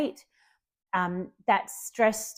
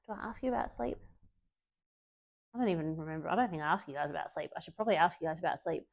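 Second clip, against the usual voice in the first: quiet, lower musing to oneself; muttered aside; 3 semitones lower, 9 dB quieter, faster than usual. Real, not acted. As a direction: wonder aloud under your breath. Thinking aloud to herself.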